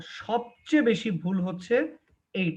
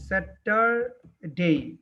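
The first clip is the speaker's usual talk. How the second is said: slow, drawn-out Saturday; teacher modelling Saturday slowly; slow model word; much slower than usual. Shown as a demonstration. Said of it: "খেয়াল করো".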